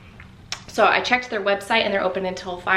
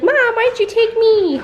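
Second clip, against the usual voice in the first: much higher, louder whiny pup voice; dog's voice; 8 dB louder than usual. Pretend. Owner voicing her dog's complaint, high and whiny. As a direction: speak for the sulking puppy. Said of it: "You're like".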